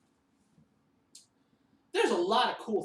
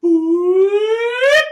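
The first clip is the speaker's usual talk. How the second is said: long, loud, high sung boop; sung high note for the kids; high held boop; 13 semitones higher, 17 dB louder than usual. Shown as a demonstration. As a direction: slide way up and hold it. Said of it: "make that sound just like Mister V".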